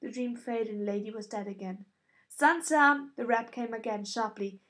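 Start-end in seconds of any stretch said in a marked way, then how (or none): 2.3-3.1 loud, sharp summons through the door; sharp call. A narrator's reading. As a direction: snap her name to wake her. Septa rapping sharply at door.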